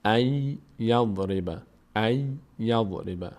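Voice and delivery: "ای یضرب" slowly, y held and doubled; merged Y. Shown as a demonstration. Teacher repeats after the rule, held Y. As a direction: stretch the y in "ای یضرب".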